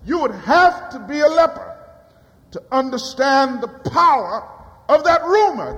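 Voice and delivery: fervent sermon delivery, ringing out; preaching at full force. Acted. Preacher building up to his flock, ringing out. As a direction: preach it to the congregation.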